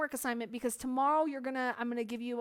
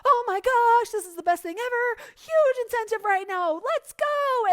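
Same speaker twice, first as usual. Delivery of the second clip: mock hype pitch, much higher and louder; hype seller; 9 semitones higher, 7 dB louder than usual. Mocking a hyped-up seller, higher, louder, over the top. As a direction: ham it up like an overexcited seller. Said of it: "So many of you sell with hype".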